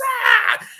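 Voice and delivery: a very high shouted "ah"; a high panicked shout; freaked-out scream. Retold or imitated. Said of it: "freaking out".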